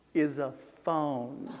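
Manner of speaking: very slow, "is" stretched with pauses; dramatic slow reveal; deadpan slow punchline; drawn-out reveal. Shown as a demonstration. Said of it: "spoiler alert".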